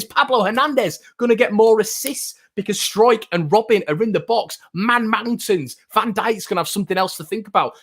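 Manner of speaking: high, rapid-fire pitch to John about the new signings; talking a doubting fan round, keyed up and high; fired-up argument. Real, not acted. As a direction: sell him hard on the big centre-backs. Passionate argument to viewer John.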